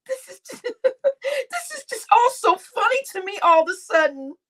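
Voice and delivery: giggling through every word; lost in a sudden laughing fit. Real, not acted. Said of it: "somebody must have given me laughing gas".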